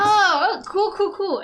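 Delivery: high, loud eager cools; light up with glee; excited. Real, not acted. Excited reaction to hit.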